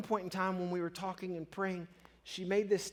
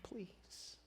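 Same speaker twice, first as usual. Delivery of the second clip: whispered plea; 3 semitones higher, 17 dB quieter than usual. Retold or imitated. Pleading prayer for friend.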